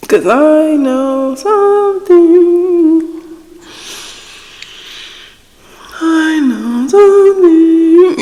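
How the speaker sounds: slow, high, stretched out; knowing drawl. Real, not acted. Vlogger hinting at gossip, slow and knowing. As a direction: drag each word, teasing.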